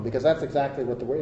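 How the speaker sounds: ordinary talk, nothing marked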